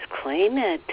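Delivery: ordinary delivery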